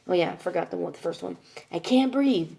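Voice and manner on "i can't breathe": higher, louder mock complaint; breathless complaint, imitated. Daughter mimicking her mother's complaint, high mock distress. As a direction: imitate mom complaining she can't breathe. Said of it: "She does this".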